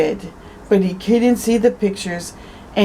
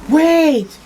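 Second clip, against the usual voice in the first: high, loud wait; urgent cry; 9 semitones higher, 4 dB louder than usual. Acted. Henry trying to stop Samuel at the carpets, urgent cry. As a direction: call out to stop him.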